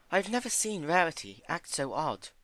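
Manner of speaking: high, gentle pony voice; high timid pony. Acted. Fluttershy voiced by narrator.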